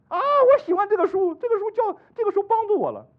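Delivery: friend quoted high and loud, gushing about his book; retold friend's reply. Preacher voicing his friend's enthusiastic reply about the book. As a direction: gush like the friend who loves that book. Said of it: "我说：“你怎么不送圣".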